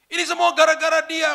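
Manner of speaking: imitating a sobbing young woman; crying complaint. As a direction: wail it out through tears. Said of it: "Tiba-tiba dia nangis".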